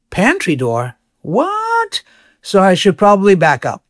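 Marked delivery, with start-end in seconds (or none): 0.1-0.9 imitating confused listener. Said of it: "You're probably like". 1.2-2.0 sharp high baffled What, pitch shooting up; mock confusion leaping high. Listener baffled by the pantry, squeaky high what. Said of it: "You're probably like".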